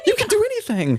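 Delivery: squeaky high laughing praise; laughing cheer. Real, not acted. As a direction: laugh while hyping her up. Cheering her friend, laughing.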